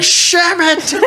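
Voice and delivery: high yelped Sham it; old man's yelp. Retold or imitated. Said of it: "He was like".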